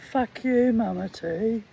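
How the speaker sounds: loud, high cheeky retort; jaw talking back. Retold or imitated. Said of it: "my jaw says".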